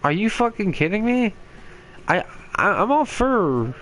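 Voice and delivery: high-pitched, indignant disbelief; incredulous outburst. Real, not acted. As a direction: voice leaping up in disbelief.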